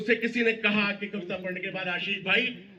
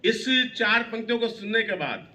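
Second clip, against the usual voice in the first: ordinary talk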